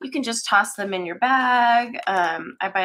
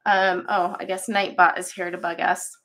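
ordinary talk

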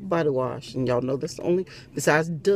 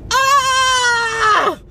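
very high shouted holler into a laugh; big yell dissolving into laughter; 7 dB louder than usual. Real, not acted. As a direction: holler it out, then crack up laughing. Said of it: "Can I holler again?".

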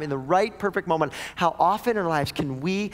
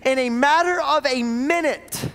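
high, louder sermon surge; preaching at full force; 9 semitones higher, 4 dB louder than usual. Acted. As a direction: drive the drama home.

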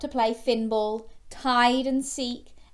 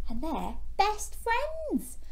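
high, slow sing-song; high drawn-out storytime coo; 12 semitones higher, slower than usual. Acted. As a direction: coo it up high to the little ones.

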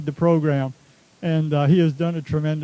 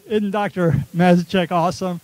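emphatic repeat. Real, not acted. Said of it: "I'll repeat it".

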